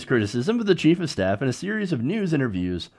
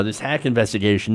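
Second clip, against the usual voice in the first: ordinary talk, nothing marked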